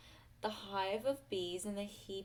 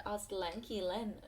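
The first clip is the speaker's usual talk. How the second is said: ordinary delivery, nothing marked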